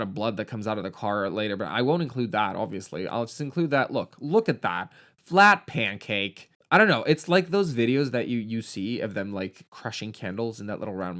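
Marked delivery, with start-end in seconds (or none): none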